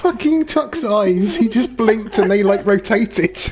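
high, slow giggly disbelief; laughing. Real, not acted. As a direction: say it through giggles. Laughing at friend's eyes.

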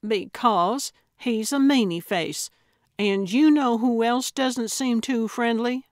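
petulant comeback with an accusing question; defensive sniping. Acted. Acted bickering with companion.

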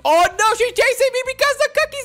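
high, fast, loud wail; playful panic. Pretend. Player fleeing Granny over cookies, mock panic. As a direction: cry out as if being chased.

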